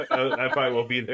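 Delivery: laughing through the quote. Retold or imitated. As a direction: giggle the line out. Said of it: "Of course, I'm an atheist".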